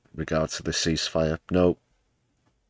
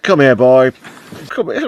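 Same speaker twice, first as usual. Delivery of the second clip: mock calling a dog; 7 semitones higher, 11 dB louder than usual.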